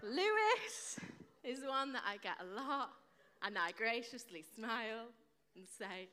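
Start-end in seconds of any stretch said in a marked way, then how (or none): 0.0-1.0 high, loud, exaggerated "Lewis"; comic outrage on LOO-iss; mock exasperation. Shown as a demonstration. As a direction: blurt "Lewis" as the worst offence. Exasperated repeat of worst mispronunciation.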